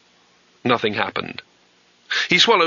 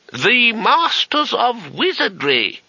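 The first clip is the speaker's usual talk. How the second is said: high, slow lofty announcement; haughty old wizard; 12 semitones higher, much slower than usual. Acted. Old wizard announcing himself to a student, haughty and high. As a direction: draw yourself up and pronounce it grandly. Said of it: "said the voice haughtily".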